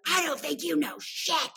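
high, mocking comeback; defiant retort. Retelling how she told him off, high defiant retort. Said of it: "and I'm like".

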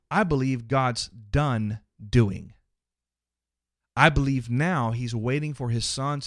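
ordinary talk, nothing marked

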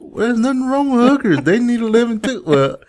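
high-pitched, rising to loud; mock indignant. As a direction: play up fake outrage for laughs. Joking defense of hookers.

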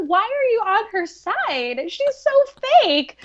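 high, drawn-out complaint; jealous mock protest. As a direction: imitate an indignant diva, pitch swooping high. Acting Song-yi's jealous protest.

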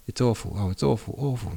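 understated cry of despair. A narrator's reading. Natasha to the prince over the year's delay, a mild anguished cry. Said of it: "Natasha suddenly cried".

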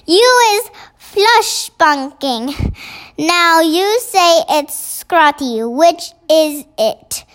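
usual speaking voice

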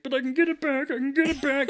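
hammed-up angry teen; mock hothead. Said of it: "And he's like".

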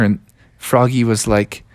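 ordinary delivery

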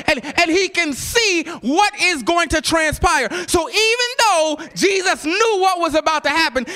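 high-pitched preacher's delivery building to a shout; impassioned sermon climax; full-force preaching. Real, not acted. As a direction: build the scripture into a ringing proclamation.

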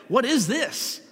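mildly astonished question, pitched high; a touch of disbelief, voice lifted; amazed. A narrator's reading. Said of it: "they were all amazed".

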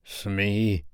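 lightly husky captain, drawn out; a hint of a quiver, husky. A narrator's reading. Hook addressing Smee with a quiver, lightly husky. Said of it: "he said huskily".

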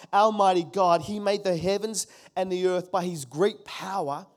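ordinary talk

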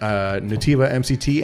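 ordinary talk

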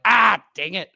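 loud, high mock exasperation; exasperated outburst. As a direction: act out an annoyed yelp at a robocall. Reacts to robocall in story.

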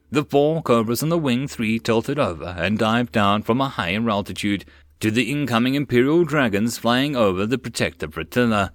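ordinary delivery

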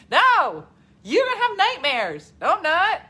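high, loud back-and-forth bickering; mock arguing. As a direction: insist loudly in a high pitch. Acts out argument with kids.

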